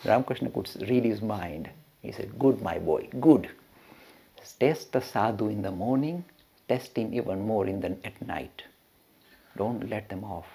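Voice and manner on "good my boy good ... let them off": very soft, low acted counsel; pleased master confiding advice to his disciple; hushed, measured approval, dropping lower; quiet approval. Master quietly approves testing him.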